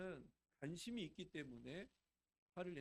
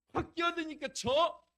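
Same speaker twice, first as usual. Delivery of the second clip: high, loud, drawn-out flare-up; angry outburst; 11 semitones higher, 14 dB louder, slower than usual. Acted. Preacher acts out a driver being cut off, angry outburst. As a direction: snap loudly at a driver cutting in.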